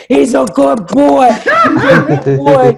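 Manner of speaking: talking to the date like a good dog; praising a dog. Retold or imitated. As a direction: coo it at him like a pet. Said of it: "and I said".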